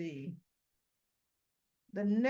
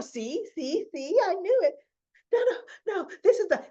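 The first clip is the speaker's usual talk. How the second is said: high, loud, flustered insistence; frazzled; 8 semitones higher, 5 dB louder than usual. Acted. Ashley protesting to Tracy that she knew it, acted frazzled. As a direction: stumble over it, acting increasingly frantic. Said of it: "Now she's getting frazzled".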